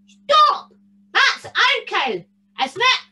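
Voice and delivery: high-pitched wee giant's shout; moderately loud giant. A narrator's reading. Wee giant echoes the big one, high shout. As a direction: squeak out a shout, ending unsure. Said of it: "a moderately loud voice".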